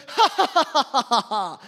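loud imitated mocking laugh; hammed-up jeering laugh; mocking laughter. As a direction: laugh at him like a jeering stranger. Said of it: "He goes".

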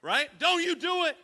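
high, loud mock plea not to throw up; hammed-up panicked warning. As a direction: warn her urgently. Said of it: "I'm like".

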